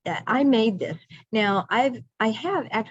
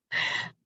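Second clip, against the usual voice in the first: laughing; 6 dB quieter than usual. Real, not acted. Laughs at her own joke.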